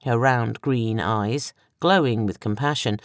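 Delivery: usual speaking voice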